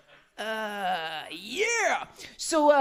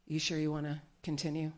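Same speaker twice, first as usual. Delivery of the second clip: much softer, lower, gently asking; hushed careful young man checking in; soft lowered voice of the young guy; soft hesitant guy; 6 semitones lower, 10 dB quieter than usual. Retold or imitated. Young guy asks consent.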